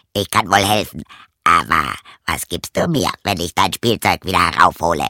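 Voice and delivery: put-on a low frog comforting the girl. Frog tells crying princess to hush, low croaky voice.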